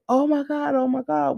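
retold screaming and crying. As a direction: imitate shock without really screaming. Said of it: "We're screaming and we're crying".

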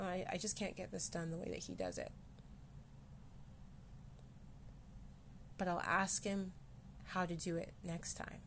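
soft, gentle reassuring self-talk. Shown as a demonstration. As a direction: speak kindly to yourself. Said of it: "Acceptance and gently speaking".

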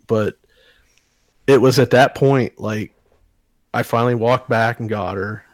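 ordinary delivery

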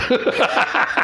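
high-pitched burst of laughter; hearty laugh. Real, not acted. Laughs at his own punchline.

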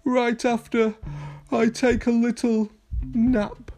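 high, sleepy pup voice; yawning. A narrator's reading. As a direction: talk through an acted yawn. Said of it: "he yawned".

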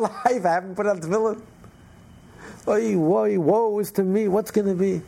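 high-pitched, wailing storyteller's lament; mock crying lament. Brother wailing so the innkeeper overhears, mock crying lament. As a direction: act out a distraught cry of woe. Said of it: "one brother starts crying".

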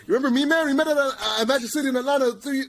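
high, rushed, squealing reintroduction; mock overeager fan. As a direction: gush at a celebrity, imitating a pest. Mocking an overeager fan's reintroduction.

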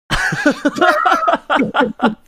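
loud, high burst of laughter; helpless laughter. Real, not acted. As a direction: crack up uncontrollably. Almost fell off the chair.

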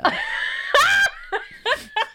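a sudden high shriek of laughter; loud shrieking laugh. Real, not acted. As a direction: burst out in a loud squealing laugh.

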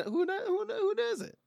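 high squeaky stammering question; hammed-up falsetto stammer.

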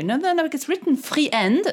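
slow, high protest, "friend" sounded as spelt, free-END; mock objection with "friend" in two syllables; student arguing back. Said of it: "they're gonna argue back with you".

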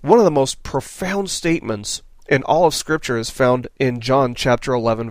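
ordinary talk, nothing marked

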